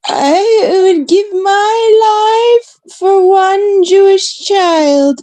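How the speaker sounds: high, slow, mock-tearful gush; mocking quote. Quoting her online fan, mock-tearful and high. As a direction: ham up her teary self-sacrifice. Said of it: "She's like".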